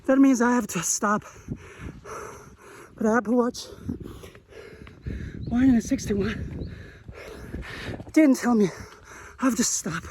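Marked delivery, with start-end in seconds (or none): 3.0-10.0 winded, pushing words out in bursts; out of breath. Real, not acted. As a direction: complain breathlessly mid-run, with long gaps. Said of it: "I'm out of breath".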